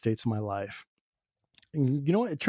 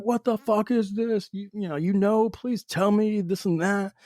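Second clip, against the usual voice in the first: mock yelling, pitched up without real volume; retold angry rant; 8 semitones higher than usual. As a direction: re-enact a frustrated, higher-pitched outburst. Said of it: "just yelling, cursing".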